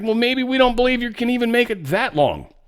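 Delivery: high-pitched, sing-song mock taunt; mocking retort. Commentator voicing one side doubting the ceasefire, mocking retort. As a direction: toss it back as a high, mocking comeback.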